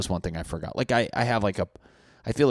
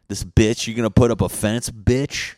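loud, higher, furious neighbor yelling; angry neighbor; 4 semitones higher, 8 dB louder than usual. Acted. Neighbor yelling over a new fence, loud and angry. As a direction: bark it at the guy building a fence.

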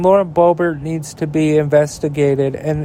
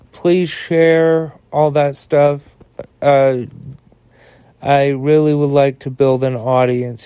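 lower, smooth sponsor-read voice; a friendly host reading the sponsor spot; podcast ad read; 3 semitones lower than usual. Acted. Host thanking listeners and pitching the sponsor, ad-read voice.

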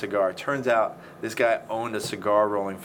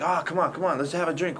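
coaxing; 6 semitones higher than usual. Retold or imitated. Coaxing bikers to drink.

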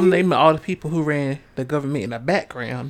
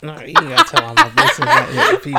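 fast, laughing, high protest; half-laughing, begging her to stop; laughing through a fake scold; 10 semitones higher, 6 dB louder, faster than usual. Real, not acted. Embarrassed friend protests, laughing.

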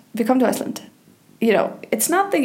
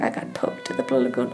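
low, soft, fast put-on voice; a lightly voiced silly slogan; mock tourist boast; 11 semitones lower, 6 dB quieter, faster than usual. Tourist bragging about Blue Lagoon mishap, low mock voice. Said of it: "You can be like".

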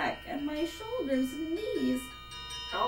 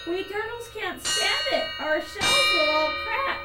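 raised, pleading protest; groaned complaint; 5 semitones higher, 5 dB louder than usual. A narrator's reading. Mack complains to the king of pain, groaning. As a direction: plead upward, growing louder and more insistent. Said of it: "groaned Mac".

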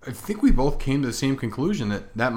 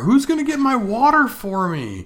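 put-on whiny big baby; 13 semitones higher, 6 dB louder than usual. Mocking sick husband as baby.

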